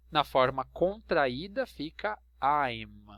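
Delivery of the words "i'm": slow, drawn-out I'm with a clear "aim" glide; careful pronunciation. Shown as a demonstration. Teacher showing students the contraction, slow and clear. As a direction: say I'm slowly, as one smooth syllable. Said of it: "Presta atenção na pronúncia".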